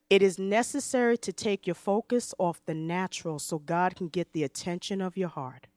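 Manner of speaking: normal talking voice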